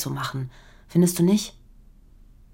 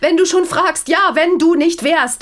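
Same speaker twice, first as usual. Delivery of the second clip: desperate outburst; 11 semitones higher, 7 dB louder than usual. Acted. Wife finally screaming the truth at her husband. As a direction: scream the admission.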